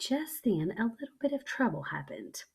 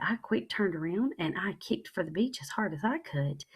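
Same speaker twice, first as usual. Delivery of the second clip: plain reading voice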